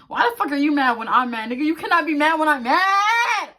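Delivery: yelling it like the gall is unbelievable; outraged yell. Acted. Outraged at boyfriend being mad.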